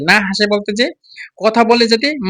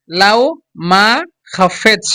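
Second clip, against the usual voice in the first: "লাও, মা, খাফেচ" very slowly with gaps between the parts; slow, broken-up demo; much slower than usual. Shown as a demonstration. Teacher drills learners on pronunciation, "লাও মাখাফেচ" slowly in separated pieces. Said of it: "একসাথে যদি তাড়াতাড়ি বলতে চান".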